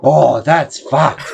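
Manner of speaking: drawn-out dismayed reaction; slow shocked reaction. Retold or imitated. Pharmacist seeing the gash, slow and shocked. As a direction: stretch it out in disbelief. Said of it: "I go".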